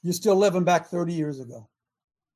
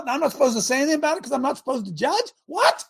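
incredulous outrage; 7 semitones higher, faster than usual. Real, not acted.

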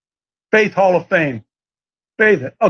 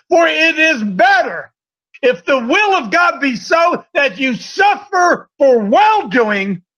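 raised, slow scripture declamation; emphatic preaching; 5 semitones higher, slower than usual. Real, not acted. Preacher reading suffering for well-doing to the congregation, slow forceful preaching. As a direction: preach it out, stretching the key words.

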